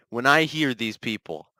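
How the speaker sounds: ordinary talk, nothing marked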